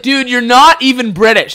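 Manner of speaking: high, loud mock outrage; incredulous protest. Partygoer insists the accent is fake, loud incredulous protest. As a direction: protest loudly as if exposing a fraud.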